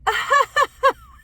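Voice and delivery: a loud, high peal of laughter; can't hold the laugh in; high burst of laughter. Real, not acted. Laughing at the memory.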